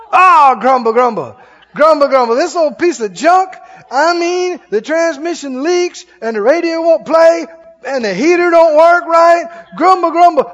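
high, loud griping; mock grumbling. Driver griping about an old car, high mock grumbling. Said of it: "go get in the car".

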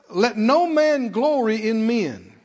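"Let no man glory in men" slow and loud; emphatic verse. Real, not acted. Preacher stops congregation on "Let no man glory in men", slow emphasis. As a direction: stretch and weight each word of "Let no man glory in men". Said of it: "to stop on that".

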